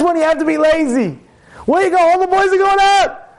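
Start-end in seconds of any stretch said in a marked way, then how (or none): 1.7-3.3 high, eager call to a friend; raised, pushy invitation; urging friends. Acted. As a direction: pester him to come out. Boys urging him out.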